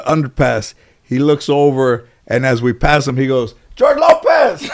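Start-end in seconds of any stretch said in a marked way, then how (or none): 3.7-4.7 high-pitched mock yell of a passerby; imitated yell. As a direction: holler it out like a heckler. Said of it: "Did that guy yell George Lopez".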